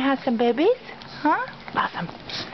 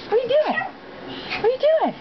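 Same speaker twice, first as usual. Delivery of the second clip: high pet talk; faster than usual. Real, not acted. Woman to her dog, high baby talk.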